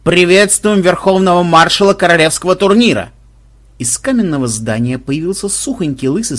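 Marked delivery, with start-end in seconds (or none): 0.0-3.1 lightly howled fanfare announcement; howling herald. A narrator's reading. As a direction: call it out across the lists. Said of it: "завывал глашатай".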